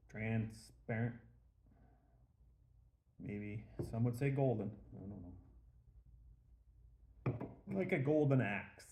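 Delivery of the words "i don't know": ordinary talk, nothing marked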